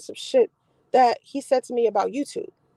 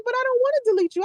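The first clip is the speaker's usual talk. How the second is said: mocking pleading guy; 11 semitones higher, faster than usual.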